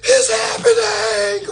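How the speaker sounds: shrill high mock panic, laughing through it; mock panicked screaming. Uninformed people screaming about a bank crash, mock panic. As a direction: scream like the world is ending, half laughing. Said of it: "always gonna be screaming".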